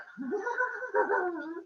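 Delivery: high, strained giggle in a changed voice; creepy high giggle. Acted. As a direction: let out a creepy high cackle. Cronus laughs menacingly.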